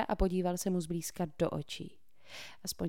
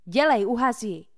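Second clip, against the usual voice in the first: loud, higher order to put it out; commanding; 5 semitones higher, 10 dB louder than usual. A narrator's reading. Cole orders Tucker to put out the firecracker: loud command. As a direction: snap the command at him. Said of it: "přikázal Cole Tuckerovi".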